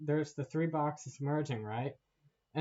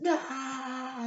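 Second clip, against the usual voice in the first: mock battle cry; 11 semitones higher than usual. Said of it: "And then it's like".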